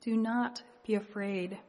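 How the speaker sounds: ordinary talk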